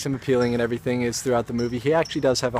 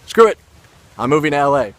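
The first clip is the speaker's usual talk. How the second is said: retold bold decision; 3 semitones higher, 8 dB louder, faster than usual. Telling himself he's leaving New York, retold bold decision. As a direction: re-enact throwing it all in, punched at the start. Retells his sudden birthday decision.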